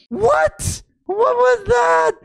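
very high squeal of disbelief; shocked shriek. Real, not acted. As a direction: shriek it, utterly baffled. Shocked at the leak.

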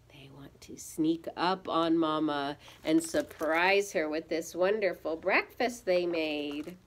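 ordinary delivery

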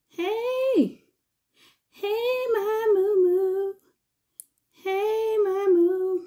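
high, loud cooing at the pet; affectionate sing-song to the pet. Real, not acted.

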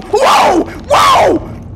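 very high, loud startled whoas; alarmed shout. Real, not acted. Player as the enemy rushes too fast, alarmed shout. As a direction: yell in sudden fright.

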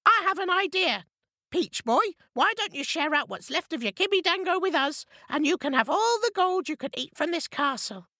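very high, coaxing bird voice; peacemaking pheasant. A narrator's reading. Pheasant proposing a deal to Peach Boy, coaxing. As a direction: talk them round like a peacemaker. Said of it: "a bit of a peacemaker".

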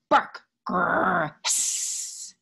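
a bark, a growl, then a hiss; loud bark and growl sliding into a hiss; animal noises. Acted. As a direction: play the pets barking, growling and hissing.

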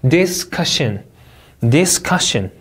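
'discussion' with the beat on CUS; second-syllable stress. Shown as a demonstration. Teacher drilling word stress, second syllable strong. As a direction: punch CUS in 'discussion', falling after. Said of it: "이음절 강세".

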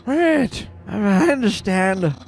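high-pitched little monster reporting to his boss; high squeaky character voice. Pretend. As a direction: squeak it out nervously, like a timid minion. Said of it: "this voice makes me so out of breath".

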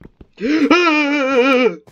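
high, loud, long gasp; startled gasp. Real, not acted. Player surviving a sudden scare in game, startled gasp. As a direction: gasp sharply in sudden alarm.

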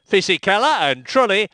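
ordinary talk

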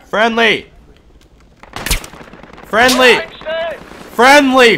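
desperate yelling; man down shout. Real, not acted. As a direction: shout it at your own team. Shot by own team, yelling.

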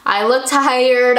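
usual speaking voice

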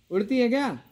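ordinary talk, nothing marked

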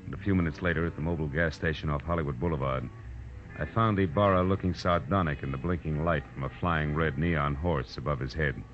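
low, even storyteller voice for the private eye's inner account; noir narration. A narrator's reading. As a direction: tell it like a tired detective looking back. Detective narrates over music.

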